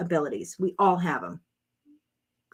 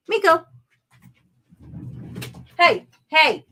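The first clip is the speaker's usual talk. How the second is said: high, loud calls at the dog; calling out sharply; 8 semitones higher, 5 dB louder than usual. Real, not acted. Owner stops dog eating tissues, calling out sharply. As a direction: get the dog's attention fast.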